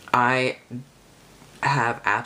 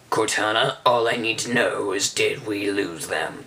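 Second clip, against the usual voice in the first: badly faked game-character voice, slightly lowered; terrible Keyes impersonation; 2 semitones lower than usual. Gamer quoting a character from Halo's storyline, terrible impersonation. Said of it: "That's a terrible Keyes impersonation".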